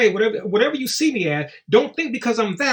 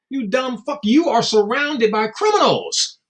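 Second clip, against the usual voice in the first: ordinary talk, nothing marked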